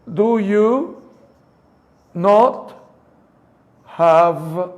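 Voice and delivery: "Do you", "not", "have" split apart; three separate sounds. Shown as a demonstration. Teacher splitting the question into three sounds for students. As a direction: break it into three spoken chunks. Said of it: "Hay tres sonidos".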